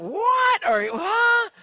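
very high, loud shocked squawk; incredulous crowd. Retold or imitated. Whole Astrodome reacting to the question, high loud disbelief.

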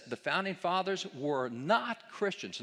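slow reading with NOT drawn out and punched; stress on NOT. Retold or imitated. Lecturer reads an article's claim to the audience, heavy stress on "not". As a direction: lean hard and long on "not". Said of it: "notice the big emphasis on the word".

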